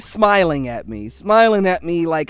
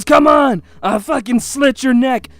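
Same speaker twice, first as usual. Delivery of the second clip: loud, higher-pitched aggressive taunt; mock shouted threat; acted-out threat; 4 semitones higher, 5 dB louder than usual. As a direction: imitate a drunk attacker daring you. Acts out attacker's threat.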